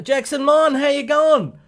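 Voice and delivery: bright, eager hello across the chat; excited greeting. Real, not acted. Greeting a regular viewer who just arrived, excited.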